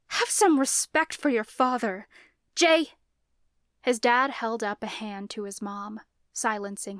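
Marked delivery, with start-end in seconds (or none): 0.1-3.0 loud, high scolding; scolding mother. A narrator's reading. Mother scolding her son for disrespect, loud and high. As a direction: scold him sharply.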